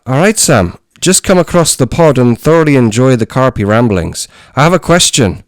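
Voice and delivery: put-on reading a listener's letter in a high, loud Irish accent; reading Phil's letter out in an Irish accent. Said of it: "in his accent?".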